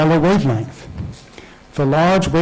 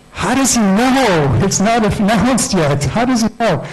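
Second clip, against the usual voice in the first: baffled; 6 semitones higher than usual. Retold or imitated. Puzzled husband asking his wife about the early text, high and baffled. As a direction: sound baffled and pitched up.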